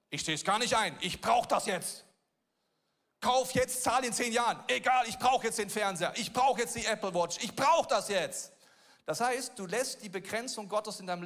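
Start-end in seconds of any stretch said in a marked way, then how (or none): 0.1-8.5 higher, louder, whiny demands; greedy consumer voice, hammed up; impulsive buyer. Voicing the impatient debtor.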